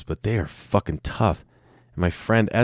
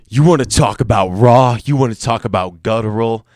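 mock hype; 4 semitones higher, 7 dB louder than usual. Real, not acted. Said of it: "mocking his own raw episode".

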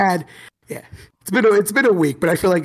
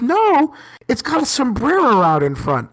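high, louder mock protest; stubborn student; 9 semitones higher, 4 dB louder, slower than usual. Student rebuts teacher about the store, mock-adamant. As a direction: imitate a student digging in, voice pitched up.

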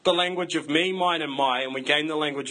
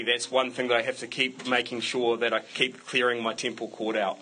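normal talking voice

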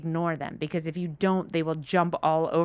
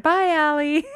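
mock cheery goodbye; 9 semitones higher, 7 dB louder than usual. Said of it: "I said".

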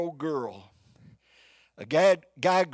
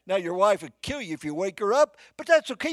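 usual speaking voice

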